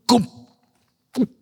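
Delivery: short hollow mouth pop, "goom"; gulping noise. Acted. Uncle mimics the snake swallowing the bird, gulping.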